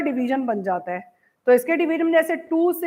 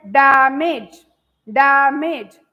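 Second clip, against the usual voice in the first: "Damage" in two beats, डै - मेज, pushed out firmly; split into syllables; 7 dB louder, much slower than usual. Shown as a demonstration. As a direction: stretch and separate both halves of "damage". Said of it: "तो एक बार डै". ("Damage" को डै और मेज में तोड़कर ज़ोर से)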